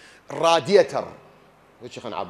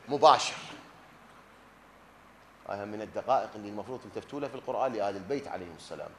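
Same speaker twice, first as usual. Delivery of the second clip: ordinary talk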